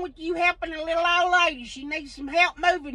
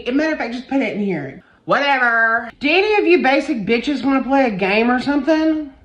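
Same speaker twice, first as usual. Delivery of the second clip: usual speaking voice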